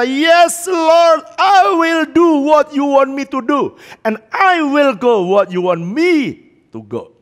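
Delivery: high, loud cry of submission, easing down; impassioned re-enacted vow, starting at full voice; fervent surrender. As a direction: call it out to God in anguished surrender. Acting out his surrender to God.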